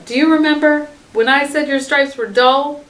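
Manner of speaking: plain reading voice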